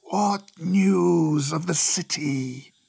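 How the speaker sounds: long-drawn serpent questioning. Acted. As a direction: draw the question out like an old serpent. Cobra questions the visitor.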